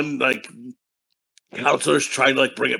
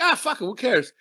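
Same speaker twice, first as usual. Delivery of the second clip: high, soft careless shrug; mock dismissive; 9 semitones higher, 4 dB quieter than usual. Promoter waving away the band's worry about kids, dismissive. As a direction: toss it off as if it hardly matters.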